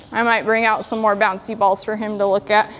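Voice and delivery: ordinary delivery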